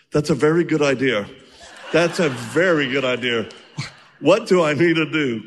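loud, laughing eager yes; eager agreement. Retold or imitated. Freed man eagerly accepting faith, loud and laughing. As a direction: blurt it, delighted and keen. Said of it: "He goes".

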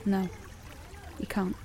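soft, bitter and final; quiet grief-hardened rebuff; cold quiet retort. Acted. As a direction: shut the sympathy down quietly. Grieving girl rebuffs sympathy.

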